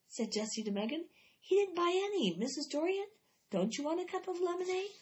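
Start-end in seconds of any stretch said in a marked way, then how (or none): 1.4-4.9 put-on higher, pleading kid voice; eager girl. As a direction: urge the neighbour to buy, pitched up. Jessie voiced, urgent with neighbour.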